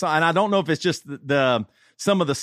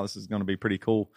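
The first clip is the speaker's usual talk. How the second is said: normal talking voice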